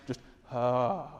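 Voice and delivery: soft, low contented sigh; relaxed purr. Shown as a demonstration. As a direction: sink into a comfy couch and let it out. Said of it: "that relaxed".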